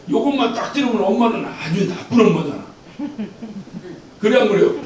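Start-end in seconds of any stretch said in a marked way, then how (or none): none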